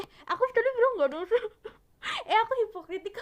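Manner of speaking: fast, loud, high laugh-cry; laughing through tears. Real, not acted. Laughing through tears after telling viewers nobody's sad. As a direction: laugh at yourself while the tears come. Catches herself crying after denying.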